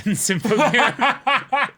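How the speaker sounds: talking through a high burst of laughter; laughing. Real, not acted. Laughing at how characters never die in Symphogear.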